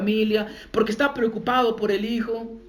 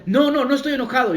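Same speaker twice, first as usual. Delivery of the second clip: higher, louder insistent no no no; defensive denial; 6 semitones higher, 4 dB louder, faster than usual. Retold or imitated. Someone denying anger while shielding themselves, louder and higher. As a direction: protest too much, hands up.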